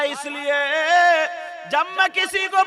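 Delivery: ringing, sustained preacher's chant; full-force preaching. Retold or imitated. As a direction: preach it at full volume, stretching key words.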